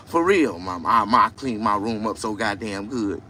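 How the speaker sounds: ordinary talk, nothing marked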